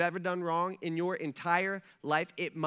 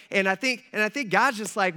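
normal talking voice

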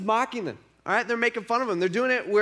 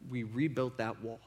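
quiet statement; 6 semitones lower, 8 dB quieter than usual. Retold or imitated. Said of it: "in defiance to them".

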